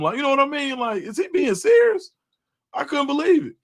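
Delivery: high, disbelieving; incredulous rant. Real, not acted. Streamer venting to chat, high incredulous rant. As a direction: push it up in disbelief.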